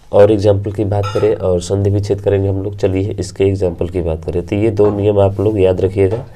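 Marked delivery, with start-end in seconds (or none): none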